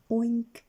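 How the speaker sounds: a sad pig's wail; mournful oink. Acted. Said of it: "wailed Mister Grumble mournfully".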